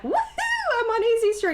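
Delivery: high-pitched celebratory whoop; mock jubilation. Acting out a woman who got the insurance, jubilant. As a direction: crow it like a sudden windfall.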